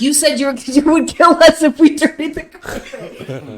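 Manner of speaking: high, loud, laughing through the quote; laughing kid reply. Retold or imitated. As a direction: retell the kid's logic while laughing. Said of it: "He's like".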